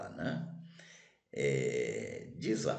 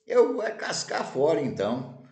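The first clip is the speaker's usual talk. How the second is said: huffy exit; 6 semitones higher, 5 dB louder than usual. Acted. Wanderer storms off.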